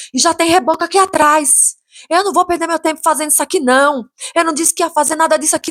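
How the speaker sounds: high-pitched indignant rant; retold angry yelling. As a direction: imitate the builder's heated tirade. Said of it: "por que que você tá gritando comigo".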